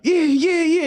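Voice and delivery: very high, excited cheering; mock hype. [name] hypes the crowd again, very high and excited.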